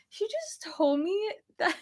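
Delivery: high, hushed incredulous report; retold disbelief. Wife telling husband at the car what she heard, retold disbelief. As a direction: relay it softly in a high, stunned voice. Retelling her shock at the car.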